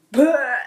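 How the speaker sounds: loud, high mock retch; retching sound. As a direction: act out throwing up. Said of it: "and I puked".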